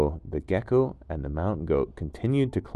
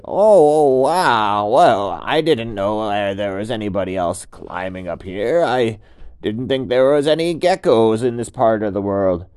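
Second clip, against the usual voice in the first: goat startled to find company; surprised goat; 3 semitones higher, 10 dB louder than usual. Acted. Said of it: "And the goat said".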